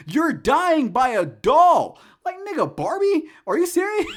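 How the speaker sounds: high loud disbelieving rant; incredulous shouting. Real, not acted. Ranting at the movie's victims, high incredulous shout.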